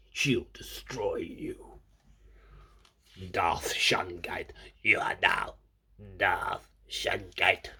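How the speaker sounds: slow, whispered Sith villain; slow low whisper, a dark lord anointing his apprentice; sinister whisper. Pretend. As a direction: hiss the threat like a dark lord. Darth Vader parody.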